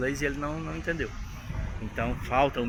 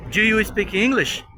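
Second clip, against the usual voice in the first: foreigner asking; 7 semitones higher, 6 dB louder, slower than usual. Retold or imitated. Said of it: "o estrangeiro chegou pra mim".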